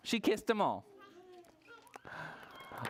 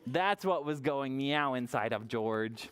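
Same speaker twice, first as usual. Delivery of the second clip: ordinary delivery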